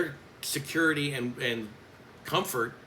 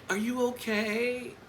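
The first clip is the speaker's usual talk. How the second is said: high, drawn-out fake sympathy; mocking concern; 10 semitones higher, slower than usual. Coach imitates colleague teasing her on campus, mocking concern. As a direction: stretch it out high like a taunt. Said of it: "trying to make fun of me".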